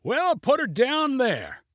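high, slow, stretched-out yell. A narrator's reading. Pilot announces landing spot, high drawn-out yell. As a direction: yell it high without much extra volume. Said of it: "yelled Junie".

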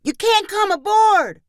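put-on very high, drawn-up kids' yell; echoed crew shout. A narrator's reading. Pirate crew echoing the refusal to policemen, yelled very high. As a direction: echo the refusal at the top of a child's voice. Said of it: "others of the crew repeated".

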